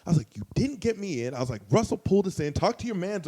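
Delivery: high, heated retold argument; acting out telling someone off; near-angry pushback. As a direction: argue back, worked up and high. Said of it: "I was damn near close".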